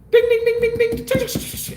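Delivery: very high sung bell dings; imitating slot machine bells, high and bright. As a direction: chime like a winning slot machine.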